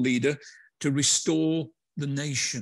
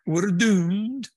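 high, loud Scottish doom-monger; Scottish accent; 7 semitones higher, 5 dB louder than usual. Retold or imitated. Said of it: "in that Scottish accent".

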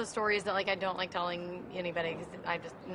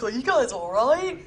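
slow and louder, concerned; concerned brother; 4 semitones higher, 8 dB louder, slower than usual. Retold or imitated. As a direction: check on them, worried. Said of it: "He's like".

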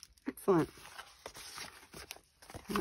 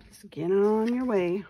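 put-on Southern accent; 4 semitones higher, 4 dB louder than usual. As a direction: shoo them off in a light Southern accent. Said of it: "A little bit of Southern accent".

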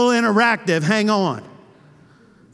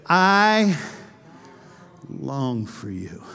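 hushed, slow and much softer; tender longing; 10 semitones lower, 4 dB quieter, much slower than usual. Acted. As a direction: breathe it out as a soft longing. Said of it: "Notice what he says".

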